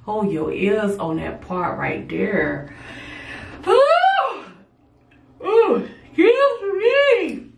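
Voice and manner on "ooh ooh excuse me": big yawn pulled up high through the words; sleepy late-night yawn, higher. Real, not acted. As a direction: yawn right through an apology. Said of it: "so I'm yawning".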